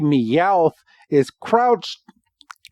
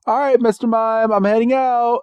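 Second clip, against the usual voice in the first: higher-pitched, cheerful-sounding call-out; Ash; 8 semitones higher than usual. Retold or imitated. Ash tells Mister Mime he's leaving, higher-pitched call. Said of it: "Ash is like".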